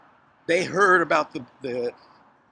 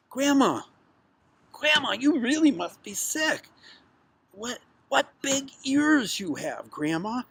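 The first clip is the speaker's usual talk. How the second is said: put-on high-pitched little girl's wonder; 9 semitones higher than usual. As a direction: marvel in a high storybook-child voice. Girl marvels at disguised wolf.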